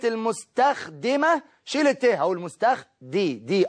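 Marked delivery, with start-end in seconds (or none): 2.4-3.7 مستخدي slowly cut short at "di", dropping the feminine ending; kasra on the dal. Shown as a demonstration. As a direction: stretch mustakh-di and repeat the "di". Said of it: "اه مكسور". (مستخدي ببطء مع إبراز كسرة الدال)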